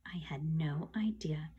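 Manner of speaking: slow, low whisper; barely voiced, drawn-out admission; hushed aside. A narrator's reading. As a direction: whisper it slowly, very soft. Narrator's hushed, clueless aside.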